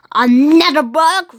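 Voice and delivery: loud disgusted pirate roar; captain roars at the book. Acted. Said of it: "roared Captain Burns".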